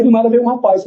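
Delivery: ordinary talk, nothing marked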